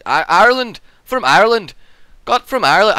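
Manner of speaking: emphatic put-on Irish "Ireland"; Irish accent. Shown as a demonstration. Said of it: "I can do Ireland".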